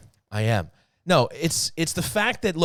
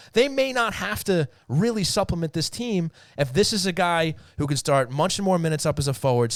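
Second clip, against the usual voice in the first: ordinary talk, nothing marked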